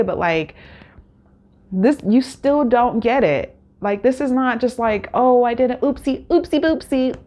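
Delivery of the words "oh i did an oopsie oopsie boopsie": mock babyish apology. Mocking contestant's childish apology.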